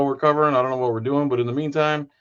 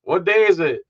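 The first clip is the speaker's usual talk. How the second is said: loud, high, drawn-out greeting; taunting Greek customer; 9 semitones higher, 6 dB louder, slower than usual. Acted. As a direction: call out as a teasing customer. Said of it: "comes in and he goes".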